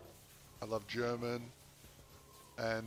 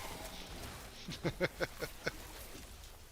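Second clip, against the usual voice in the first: breathy laugh; 5 semitones higher, 5 dB quieter than usual. Real, not acted. Laughs after his impression.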